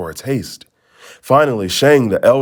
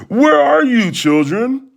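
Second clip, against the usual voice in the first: much higher, louder call; wolf shouting; 13 semitones higher, 4 dB louder than usual. Acted. Wolf shouts for the children, loud. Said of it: "the wolf shouted".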